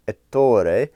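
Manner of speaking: Ettore in a careful Italian accent. Shown as a demonstration. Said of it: "trying to do my best Italian accent".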